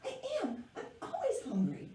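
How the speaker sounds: squeaky giggling caterpillar voice; high puppet caterpillar voice. Acted. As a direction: play a cheerful little hungry bug. Puppet caterpillar answers the host.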